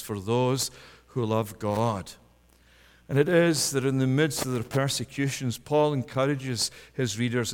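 normal talking voice

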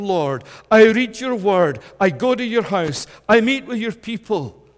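high, loud, desperate cry to God; grieving believer crying out loud to God; anguished pleading prayer. Acted. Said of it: "you're at the point when you're saying".

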